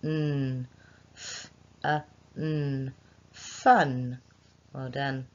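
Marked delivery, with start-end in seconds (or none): 0.0-0.7 a hummed n on its own; isolated n sound. Shown as a demonstration. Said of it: "the last one". 1.2-2.9 "F, a, n" sounded one by one; segmented phonemes. Shown as a demonstration. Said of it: "let's do it together".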